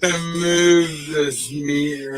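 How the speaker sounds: drawn-out slow-motion voice; slow motion replay. Shown as a demonstration. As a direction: stretch every word like slow motion. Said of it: "super slow motion".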